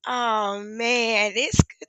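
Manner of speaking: ordinary talk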